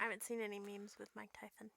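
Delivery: fast hushed whisper. Real, not acted. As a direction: whisper it quickly. Whispered aside.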